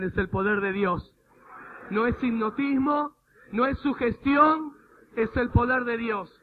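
loud, slow, emphatic preaching; forceful proclamation. Real, not acted. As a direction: declare each phrase with full force.

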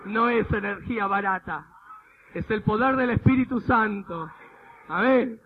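loud, emphatic preaching shout; forceful proclamation. Real, not acted. Preacher corrects those who call it energy, loud emphatic proclamation. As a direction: proclaim it with full force to the crowd.

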